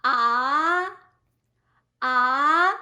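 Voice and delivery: "ah" climbing steadily in pitch, loud; rising second tone. Shown as a demonstration. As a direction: glide a long "ah" upward. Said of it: "it's rising tone".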